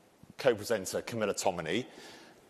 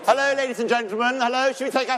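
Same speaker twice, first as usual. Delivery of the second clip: higher and louder, a booming host's hello; loud welcome; 11 semitones higher, 8 dB louder, faster than usual. Real, not acted. Host greeting the audience at a panel, loud and high. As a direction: call the room to order like a showman.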